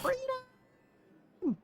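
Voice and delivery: very high robot squeal of freedom; tiny robot voice yelling freedom; robot cry. Acted. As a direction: shriek like an escaping robot. Robot escapes out the door.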